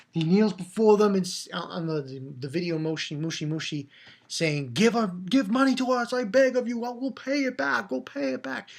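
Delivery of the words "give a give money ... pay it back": higher, pleading voice of a kneeling king; begging king. Retold or imitated. A humbled king begging his people for money, mildly pleading. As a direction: beg them for money, lightly acted.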